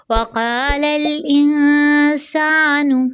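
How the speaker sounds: slow chanted वक़ालल इंसानु; Quranic recitation. Shown as a demonstration. Teacher recites the verse slowly for students. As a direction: recite slowly, falling at the end. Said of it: "नून बारीक हरफ है".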